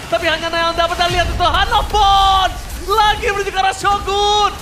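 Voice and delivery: excited esports caster calling the fight; rising hype commentary; live play-by-play. Real, not acted.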